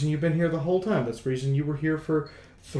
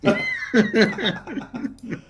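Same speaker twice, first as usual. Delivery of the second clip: high burst of laughter; 13 semitones higher, 3 dB louder than usual. Real, not acted. Big laugh at the joke.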